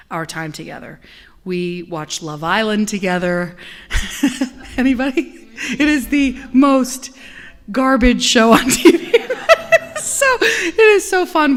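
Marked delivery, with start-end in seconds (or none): 4.2-11.6 giggling through the words, high and loud; laughing. Real, not acted. Minister laughing about watching Love Island with her mom. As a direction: laugh about the trashy show.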